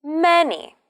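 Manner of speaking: "Many" high on "Ma", falling; MA-ny with a high first beat; stress first syllable. Shown as a demonstration. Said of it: "The stress is on the first syllable".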